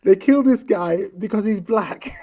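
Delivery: real outrage with incredulous laughter; outraged disbelief. Real, not acted. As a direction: laugh in disbelief while shouting it out. Outraged rant about a killing.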